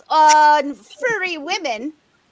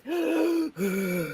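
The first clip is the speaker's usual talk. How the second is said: imitated heavy gasping; 3 semitones lower, 11 dB quieter than usual. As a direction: mimic someone's heavy breathing down the mic. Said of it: "all I could hear was this".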